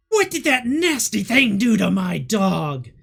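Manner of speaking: loud, indignant witch voice; angry Baba Yaga. Acted. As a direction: demand an answer for her hurt dog. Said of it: "Baba Yaga turns and says".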